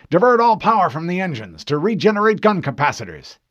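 loud, high, urgent order; commanding captain. Acted. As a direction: command the crew under fire.

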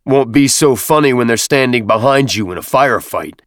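stern sergeant's reprimand; growled. A narrator's reading. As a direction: growl a warning at the snickerers. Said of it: "Avery growled".

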